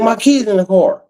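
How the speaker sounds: high-pitched mock protest; quoted mother. Mother protesting about her kid, high mock upset voice. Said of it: "She was like".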